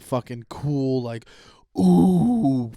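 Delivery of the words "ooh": long, loud, high "ooh"; excited ooh. Acted. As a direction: ooh with drawn-out mock awe. Acting out excited preview reaction.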